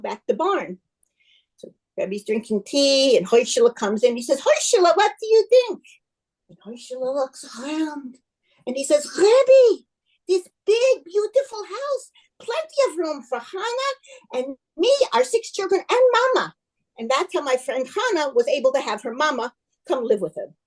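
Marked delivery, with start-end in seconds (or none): 4.4-5.8 high, loud, triumphant asking; excited question. Acted. Rabbi proudly asks for verdict. 9.2-16.5 amazed delight. Acted. Husband marvels at his roomy house.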